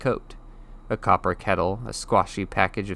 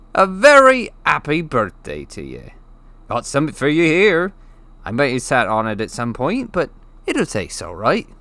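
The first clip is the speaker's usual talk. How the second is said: higher, louder, beaming giant voice; cheery giant; 6 semitones higher, 8 dB louder than usual. Acted. The giant wishes Harry happy birthday, cheerful. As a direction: hand over the squashed cake proudly. Hagrid gives Harry cake.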